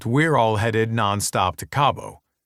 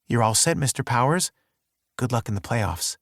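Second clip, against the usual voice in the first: sultry voice. A narrator's reading. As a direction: purr it, lightly flirting. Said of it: "she says in a sultry voice".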